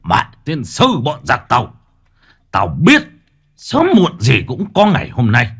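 angry, rising to a high shout; hissing with rage. A narrator's reading. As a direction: spit it out in fury. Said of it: "Ông rít lên".

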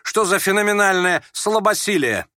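high, loud and scornful exclamation; indignant yell. A narrator's reading. Ferdyshchenko scoffing at the youth's weakness, yelling. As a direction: hurl it out in mocking disbelief. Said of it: "заорал Фердыщенко".

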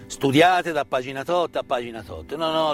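usual speaking voice

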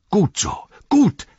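high, loud cries of approval; eager shout. A narrator's reading. Farmer hearing the uprising begins, high eager shout. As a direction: cheer the plan on. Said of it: "rief der Bauer Katun".

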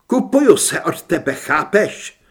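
loud, insistent finish; defiant declaration. A narrator's reading. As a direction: drive it home and demand understanding. Son defiantly buys his freedom. (vzdorné, hlasité prohlášení)

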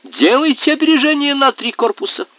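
high-pitched eager shout; shouting advice. Acted. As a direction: call out instructions excitedly.

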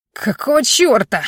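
sharp startled outcry; sudden cry. A narrator's reading. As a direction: cry out in shock. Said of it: "вдруг вскрикнул он".

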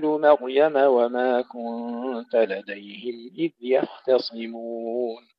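slow melodic tajweed chant; measured, reverent recitation; Quran recitation. Acted. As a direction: recite each verse slowly and melodiously. Recites Quran verses before teaching.

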